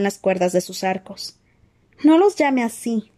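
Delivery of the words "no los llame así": higher and slower, outraged; a girl's indignant cry; indignant shriek. A narrator's reading. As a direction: protest sharply, shocked. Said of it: "chilló Hermione indignada".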